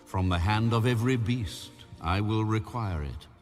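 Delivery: usual speaking voice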